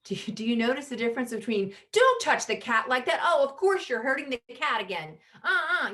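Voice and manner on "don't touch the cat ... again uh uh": high, loud whiny complaint; victim voice. Shown as a demonstration. Mother scolding child about the cat, whiny victim voice. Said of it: "me going into my victim".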